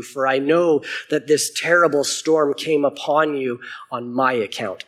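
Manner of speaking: steady Bible reading voice; clear, measured scripture reading. A narrator's reading. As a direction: read the passage out to the congregation. Reads Book of Jonah aloud.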